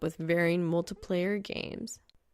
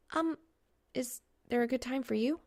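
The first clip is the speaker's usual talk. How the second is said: a hint of a nervous young woman; lightly voiced flustered girl; 5 semitones higher than usual. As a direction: ask quickly, suddenly unsure. Narrator voices Reaper, flustered.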